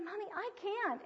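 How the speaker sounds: put-on high-pitched child's plea; imitated pleading. Daughter begging out of the punishment, high imitated plea.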